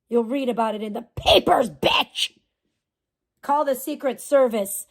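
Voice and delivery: ordinary talk, nothing marked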